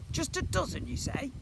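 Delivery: high, playful teasing question; mischievous. A narrator's reading. Slime teasing the boy, mischievous high question. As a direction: ask it with high-pitched mischief. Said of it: "asked Slime mischievously".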